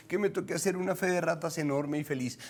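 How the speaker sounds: normal talking voice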